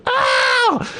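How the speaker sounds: sharp, very high yelp of pain. Retold or imitated. Girlfriend's arm scratched, high yelp of pain. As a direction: yelp as if the skin just broke. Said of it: "she went".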